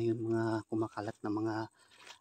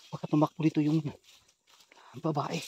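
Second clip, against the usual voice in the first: ordinary talk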